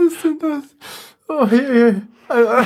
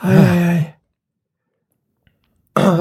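ordinary delivery

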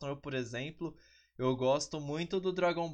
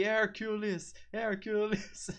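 crowd shouting; 7 semitones higher, slower than usual. Retold or imitated. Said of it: "é mais legal do que".

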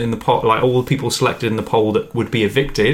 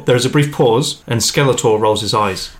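normal talking voice

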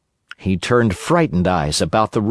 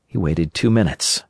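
usual speaking voice

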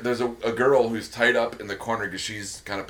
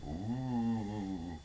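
ghost voice; much slower than usual. Pretend. Joking ghost voice answer.

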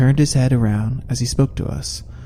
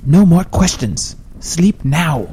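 higher, firm and exasperated; impatient order; 5 semitones higher, slower than usual. A narrator's reading. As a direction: shut down the questions with a firm command. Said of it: "grew impatient with all the questions".

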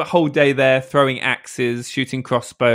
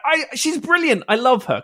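high-pitched, admiring gush; retold gushing; 12 semitones higher than usual. Retells his own gushing about comedian.

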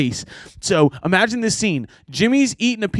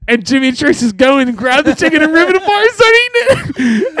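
laughing while telling; 10 semitones higher than usual. Real, not acted. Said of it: "laughing through the story".

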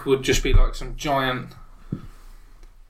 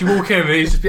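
usual speaking voice

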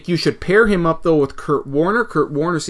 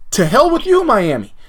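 sudden high, exasperated cry of disgust; exasperated outburst; 5 semitones higher than usual. Retold or imitated. Fan cursing Miami for passing on Goedert, exasperated outcry. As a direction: throw up your hands and curse the rival. Cursing out rival team's pick.

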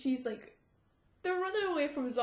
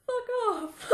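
laughing retort; 11 semitones higher than usual. Retold or imitated.

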